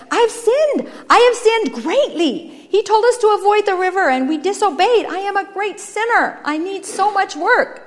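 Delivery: high, loud, emphatic confession; impassioned self-condemnation; anguished penitent. Acted. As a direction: cry out your guilt, slowing on key words.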